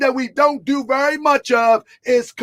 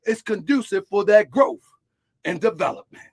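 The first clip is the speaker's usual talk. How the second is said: preaching; 3 semitones higher than usual. Real, not acted. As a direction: preach it to the congregation. Preaching a sermon.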